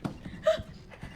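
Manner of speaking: sharp high airy gasp; breathless startled gasp. Real, not acted. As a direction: caught off guard.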